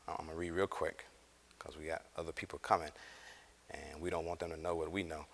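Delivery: hushed and quick, playfully conspiring; mock secretive hush. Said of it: "I'm just teasing".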